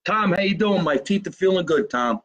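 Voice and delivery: usual speaking voice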